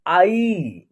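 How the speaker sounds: "आई" with a long final vowel; pronunciation demo. Shown as a demonstration. Teacher showing how the letter is pronounced, "आई" drawn out. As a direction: stretch "आई" and let it fall. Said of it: "तो इसका उच्चारण होगा".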